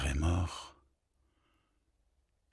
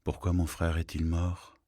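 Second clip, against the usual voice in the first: ordinary delivery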